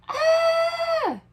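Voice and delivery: high shriek of alarm; startled scream. A narrator's reading. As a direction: scream as the baby grabs your ear. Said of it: "they both yelled".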